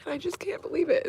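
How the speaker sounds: high, tearful, breaking voice; overwhelmed happy tears, voice up high; crying. Real, not acted. Overwhelmed after swimming with dolphin.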